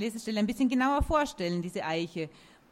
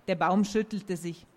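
usual speaking voice

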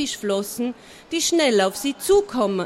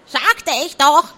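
louder, high huffy cluck; offended hen; 5 semitones higher, 7 dB louder than usual. A narrator's reading. The hen cackling offended at Schrottauge. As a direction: cluck it in a sulk. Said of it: "gackerte das Huhn beleidigt".

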